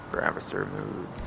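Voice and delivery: usual speaking voice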